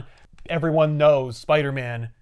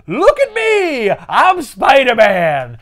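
high, slow, loud goofy yell; Adam Sandler impression; 9 semitones higher, 8 dB louder, much slower than usual. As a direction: ham up a dopey Sandler shout. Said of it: "I say it like Adam Sandler".

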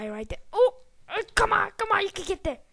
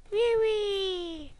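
high squeal; 9 semitones higher than usual. Real, not acted. Flying through air.

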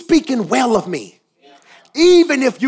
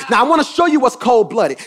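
usual speaking voice

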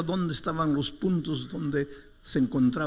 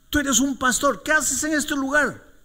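high, loud cry of outrage; sudden high-pitched yell in a retold quarrel; acted angry shout; 14 semitones higher, 6 dB louder than usual. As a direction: shout it as the man confronting his pastor. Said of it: "me comenzó a gritar".

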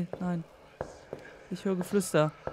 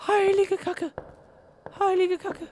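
thin, high-pitched shock; startled high swearing; frightened squeal; 10 semitones higher than usual. Real, not acted. Fright at a noise in game.

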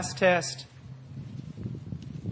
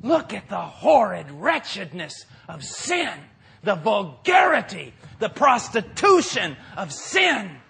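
preaching at full force; 2 semitones higher, 4 dB louder than usual. Acted. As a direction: rail against it from the pulpit. Preaching against sin at full force.

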